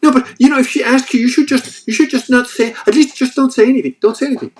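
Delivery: high, loud, pleading Italian wife voice; wife's voice. Retold or imitated. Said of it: "And you're like".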